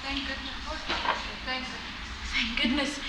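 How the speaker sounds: very soft, repeated relief; relieved muttering. Acted. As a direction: say it under your breath, relieved. Relieved mother after daughter's answer.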